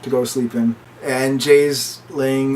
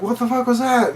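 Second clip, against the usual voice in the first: retold alarm; 10 semitones higher than usual. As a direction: act out a baffled, startled reaction. Retold shocked reaction to blue lights.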